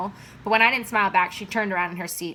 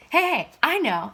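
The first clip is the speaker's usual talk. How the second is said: excited; 7 semitones higher than usual. Acted. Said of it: "said Dad excitedly".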